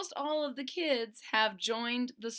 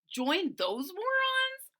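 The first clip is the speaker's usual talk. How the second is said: high, loud, slow outraged cry; indignant cry; 10 semitones higher, 5 dB louder, slower than usual. Acted. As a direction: cry out in disbelief. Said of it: "cried Boland".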